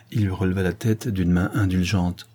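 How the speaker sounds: plain reading voice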